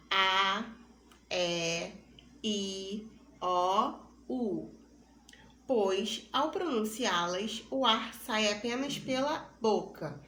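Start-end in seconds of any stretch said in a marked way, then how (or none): 0.1-4.7 each vowel A, E, I, O, U sounded separately and open; oral vowel demo. Shown as a demonstration. Teacher demonstrates pure oral vowels to the class. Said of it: "quando pronunciamos apenas as vogais".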